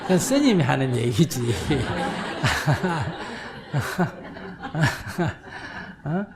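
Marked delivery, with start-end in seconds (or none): 1.4-6.0 long breathy laugh. Real, not acted. Monk laughs at his own mock lecture to mom, breathy laugh.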